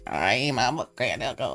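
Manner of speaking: failed flat robot voice. Shown as a demonstration. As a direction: try a synthesized robot voice. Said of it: "I can't make a, a robotic voice".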